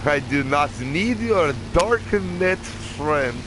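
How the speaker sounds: high, slow mock Russian; fake Russian accent. Gamer joking with pretend comrade, fake Russian accent. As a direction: refuse in a hammed-up Russian accent. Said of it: "that's my fake Russian accent".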